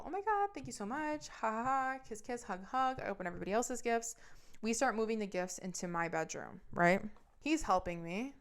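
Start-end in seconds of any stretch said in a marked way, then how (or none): 0.0-3.0 high, over-sweet fake gratitude; pretending to be delighted with a gift, sugary fake thanks; fake gushing thanks. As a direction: gush like you love a gift you don't. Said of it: "I play pretend and go".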